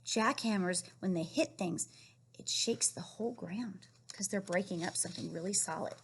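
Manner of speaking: ordinary talk, nothing marked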